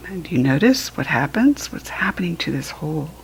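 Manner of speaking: soft hushed question; whispering. Real, not acted. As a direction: whisper it to a little one. Whispering to child about hole.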